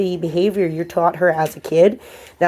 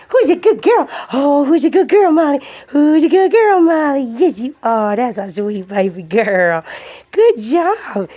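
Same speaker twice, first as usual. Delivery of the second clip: gushing dog praise; 7 semitones higher, 4 dB louder than usual. Real, not acted. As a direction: pour on the doggy praise.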